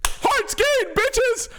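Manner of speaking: high, loud victory shout; gleeful yell. Real, not acted. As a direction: shriek in triumph. Gloating at heart gained.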